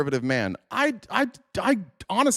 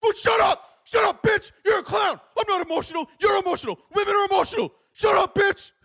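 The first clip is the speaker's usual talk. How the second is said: mock angry chatter. A raging man in chat, mocked with a high shriek. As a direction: screech it like a raging commenter. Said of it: "freaking the fuck out".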